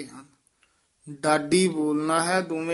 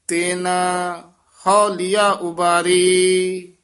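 slow, raised reading of the verse; Gurbani recitation; 2 semitones higher, 7 dB louder, much slower than usual. Acted. Teacher reciting the shabad for the student. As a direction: chant the hymn out solemnly.

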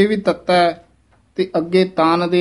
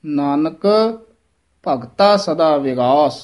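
Nanak, pause, then slow ਭਗਤਾ ਸਦਾ ਵਿਗਾਸ; pause after Nanak; slower than usual. Shown as a demonstration. Teacher demonstrates the pause at Nanak. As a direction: rest on Nanak before going on. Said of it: "Nanak ਪਦ ਤੇ ਵਿਸਰਾਮ ਹੈ".

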